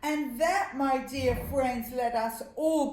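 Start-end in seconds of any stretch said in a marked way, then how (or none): none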